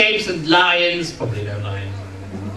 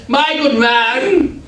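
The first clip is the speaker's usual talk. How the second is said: slow mock posh; 4 semitones higher, much slower than usual. Posh greeting, repeated.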